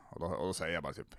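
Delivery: ordinary delivery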